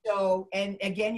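ordinary talk

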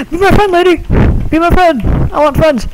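high-pitched mock begging; eager pleading. Ghost begs a passing lady to befriend it, eager high plea.

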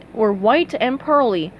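ordinary delivery

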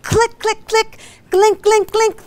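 playful, high mock clicking; car clicking noise. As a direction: tick out each click like a dead starter. Imitating the dead car's clicking.

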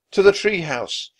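loud, high rallying cry; urgent cry. A narrator's reading. Jack to Annie as Vikings stare down, crying out. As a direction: cry out to flee. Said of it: "cried Jack".

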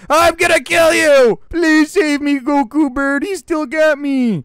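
high, loud squawk for help; panicked other bird. Acted. Captured bird begging Goku bird to save him, high loud cries. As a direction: shriek while being carried off. Grabbed bird's voice, screaming.

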